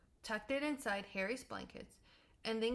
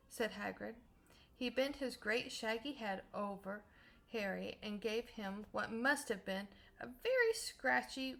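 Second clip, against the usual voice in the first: usual speaking voice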